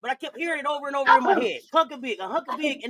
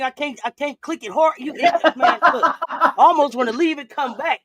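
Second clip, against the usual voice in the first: laughing hard; 5 dB louder than usual. Real, not acted. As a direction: talk through helpless laughter. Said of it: "from laughing about a Hunker Big".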